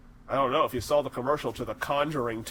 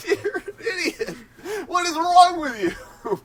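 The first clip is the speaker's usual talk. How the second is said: laughing mock scold; 5 dB louder, slower than usual. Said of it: "He goes".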